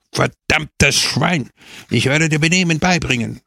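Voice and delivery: furious shout at the dog; screaming. A narrator's reading. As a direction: tear into the dog. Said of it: "schrie er".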